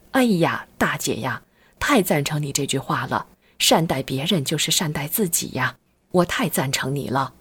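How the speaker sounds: ordinary delivery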